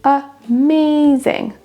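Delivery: "Amazing" very slow, a-MAAA-zing, high; second-syllable stress. Shown as a demonstration. Teacher drills learners, "Amazing" stressed on MA. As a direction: hold MA in "Amazing". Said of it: "C'est la deuxième syllabe qui ressort plus".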